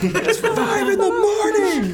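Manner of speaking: high, slow laughing yell; angry yelling. Acted. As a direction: yell like a woken neighbour. Angry neighbour yelling at goblin.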